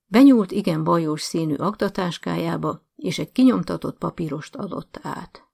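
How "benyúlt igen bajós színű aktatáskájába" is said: usual speaking voice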